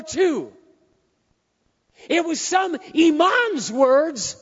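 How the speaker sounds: full-force preacher's shout, high-pitched; impassioned shouting. Real, not acted. Preacher to congregation on words that kill, shouting. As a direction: thunder it out in slow, hammering phrases.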